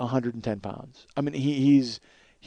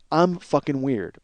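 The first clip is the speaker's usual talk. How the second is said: ordinary talk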